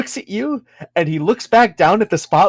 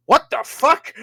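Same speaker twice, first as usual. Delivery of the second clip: higher-pitched mock disbelief; surprised dwarf; 7 semitones higher than usual. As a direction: act out a startled reaction. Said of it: "He goes".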